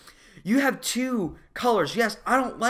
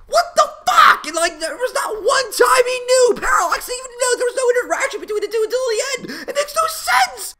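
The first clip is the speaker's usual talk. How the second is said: a critic losing it at the plot; a squealing, laughing rant way up high; furious shout; 6 dB louder than usual. Real, not acted. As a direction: yell it high and loud in real outrage. Ranting about the plot.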